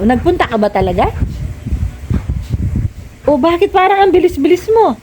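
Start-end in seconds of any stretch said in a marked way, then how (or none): 3.3-5.0 raised pitch, suspicious nagging. Real, not acted. Parent doubting Mika really pooped, suspicious nagging. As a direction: press her like a doubting parent.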